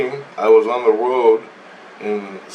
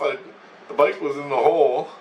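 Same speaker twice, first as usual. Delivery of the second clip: ordinary talk, nothing marked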